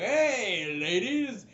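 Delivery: loud, high drawn-out greeting; swaggering half-orc. Acted. Half orc opens door to the ladies, loud greeting. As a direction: hail them with a big showy call. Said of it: "it's the half orc".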